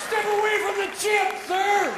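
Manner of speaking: high, loud mock order; agent's command. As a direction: shout a warning as a security agent. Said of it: "Secret Service are going".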